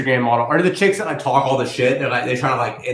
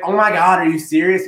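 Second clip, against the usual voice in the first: higher, louder imitation of an appalled woman; mock indignation; 7 semitones higher, 5 dB louder than usual. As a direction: act out her disbelief.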